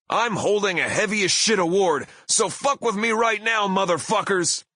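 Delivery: high, loud heel challenge; pro wrestler bellow. Retold or imitated. Singer hoisting trophy at angry crowd, wrestler bellow. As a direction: dare the whole crowd to fight. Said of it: "bellowed in my best professional wrestler voice".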